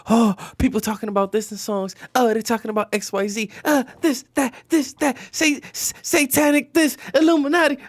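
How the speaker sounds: high-pitched, panicky mimicry of people; mock alarmed gossipers. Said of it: "People always say".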